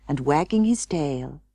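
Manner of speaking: ordinary delivery, nothing marked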